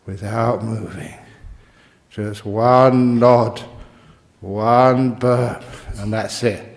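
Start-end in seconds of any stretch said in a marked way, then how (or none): 2.1-6.6 loud, drawn-out mock-stern warning. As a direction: stretch each word like a playful threat. Joking warning of strict rules.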